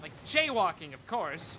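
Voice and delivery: loud disclaimer. Shown as a demonstration. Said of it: "We could say loudly into the microphone".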